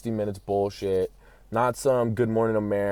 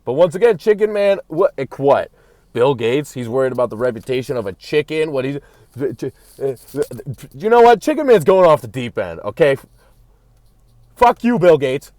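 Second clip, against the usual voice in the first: ranting outburst; 5 semitones higher, 9 dB louder than usual. Real, not acted. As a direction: explode with indignant fury. Chicken Man goes off the deep end.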